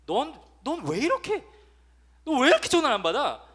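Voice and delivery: 높고 크게 몰아붙이는 성난 엄마 흉내; 전화 안 받는 자식에게 버럭 소리치는 엄마; 다혈질 엄마. Retold or imitated. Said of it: "약간 좀 다혈질인 엄마 아빠들". (다혈질 엄마가 자식에게 높고 크게 쏘아붙이는 목소리)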